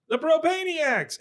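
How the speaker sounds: high, drawn-out mock group cheer; crowd cheer. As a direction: shout the new name as a toasting crowd. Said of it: "everybody around them".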